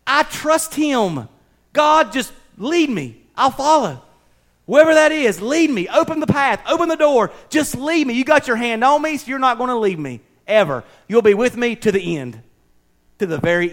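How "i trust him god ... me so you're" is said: fervent prayer. Acted. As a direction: pray it with rising fervour. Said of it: "If God's got his hand on me".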